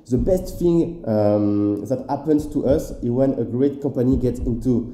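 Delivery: English read out slowly with a strong French accent; lent, accent français marqué sur l'anglais. Shown as a demonstration. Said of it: "je pense que tu kiffes mon accent".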